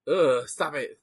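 disgusted groan, then stop it; grossed out and fed up; annoyed ugh. Real, not acted. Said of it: "I hate it so fucking much".

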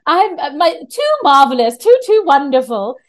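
imitating a broad Mayfair British actress; Mayfair British accent. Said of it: "big, broad Mayfair British accent".

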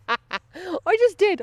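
high laughing words, drawn out slowly. Real, not acted. Laughing at the dog story, giggling slowly.

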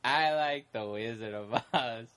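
put-on low, very slow dopey kid voice; mock oddball child, deep and plodding; weird kid. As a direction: imitate an odd kid, dragging every word. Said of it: "that stupid weird kid behind him".

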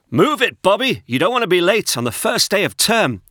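loud dad calling up the stairs; shouting upstairs. A narrator's reading. Dad shouts up to Bobby's bedroom, loud and hurrying. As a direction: call up to a late son, loudly. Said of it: "A shout bowled up the stairs".

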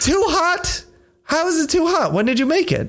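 high, mock-disbelieving questions; incredulous. Real, not acted. Teasing the co-host about his hot tea, high and disbelieving. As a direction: act baffled that tea could be too hot.

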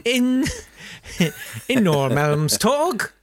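slow mock Swedish Norrmalmstorg; Swedish accent. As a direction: draw out Norrmalmstorg with a Swedish lilt. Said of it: "That's how you do the Swedish accent".

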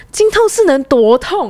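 slow dismissive question; slow incredulous doctor; scornful disbelief. Retold or imitated. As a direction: drawl it doubting the pain. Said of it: "他有点不可置信".

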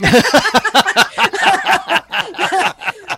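loud high burst of laughter; big laugh. Real, not acted. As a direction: laugh hard and long. Laughing at own story.

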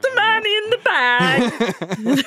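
very high-pitched fake lineup voice; disguised voice. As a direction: squeak it out high to avoid being recognized. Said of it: "do it in your real voice".

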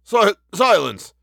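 high, slow, frightened stammer; breaking word. A narrator's reading. Inspector hushing the policeman, frightened, word breaking. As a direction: command it with the word breaking in fear. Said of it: "with a queer break in the word".

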